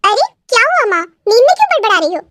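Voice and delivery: lightly startled questioning; puzzled concern. Acted. Daughter asking her muttering mother what happened, mildly surprised.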